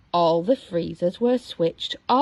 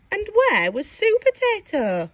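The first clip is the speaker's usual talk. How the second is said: ordinary delivery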